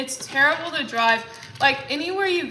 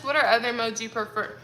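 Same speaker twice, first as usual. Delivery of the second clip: ordinary delivery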